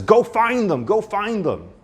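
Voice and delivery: high, pleading urgent voice; urgent amygdala. Retold or imitated. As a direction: urge them to go searching. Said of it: "The amygdala says".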